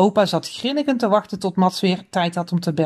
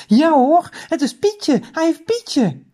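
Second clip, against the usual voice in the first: put-on high, delighted children's exclamations; excited discovery; 8 semitones higher, slower than usual. A narrator's reading. Children spotting Pietje in the cage, excited. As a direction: announce the find with lifted pitch.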